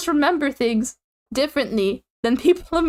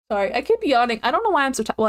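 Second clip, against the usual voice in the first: usual speaking voice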